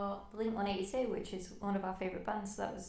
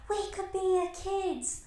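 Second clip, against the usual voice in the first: a higher, slow mock Australian girl voice; playful Australian accent impression; Aussie accent; 11 semitones higher, 5 dB louder, slower than usual. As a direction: imitate a teenage Aussie girl's accent. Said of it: "that's my Aussie accent".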